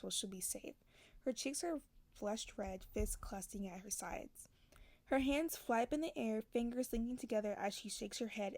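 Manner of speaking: low-key reading of a romance novel; quiet audiobook-style reading; story narration. A narrator's reading. Reads novel passage aloud.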